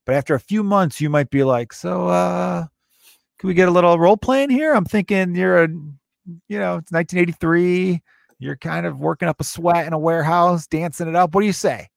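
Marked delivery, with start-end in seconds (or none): 1.7-11.9 higher-pitched mock sweet-talking boyfriend; tentative come-on. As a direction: float a playful role-play request in a raised voice. Imagined date proposing role play.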